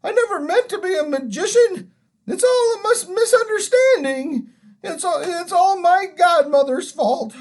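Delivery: put-on high, loud, tearful old man's lament; wailing. A narrator's reading. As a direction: whine and wail in self-pity. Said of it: "it was wailing on".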